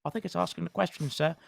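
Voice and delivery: quick laughing self-correction; laughing aside. Real, not acted. Game master laughing while fixing his own line. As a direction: break character to fix the line, amused. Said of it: "GM breaks to check phrasing".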